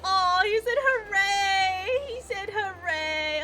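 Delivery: high, loud, slow squeal of joy; overjoyed teary squeal; squealing delight. Real, not acted. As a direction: gush over him, nearly crying with joy. Delighted by cute character.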